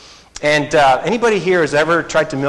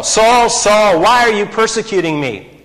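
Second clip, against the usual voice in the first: calling out; 6 semitones higher, 5 dB louder than usual. Acted. Jesus calls out to Saul.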